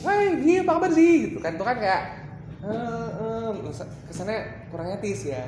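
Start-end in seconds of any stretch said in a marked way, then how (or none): 0.1-1.1 very high, loud demo yell; shouted greeting. Shown as a demonstration. Trainer showing poor etiquette in small places, shouted greeting. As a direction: holler hello far too loud for the room. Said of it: "kecil tapi teriak".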